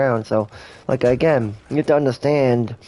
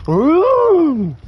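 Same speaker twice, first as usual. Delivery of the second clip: spooky ghost ooh; 5 dB louder than usual. Pretend. That's spooky.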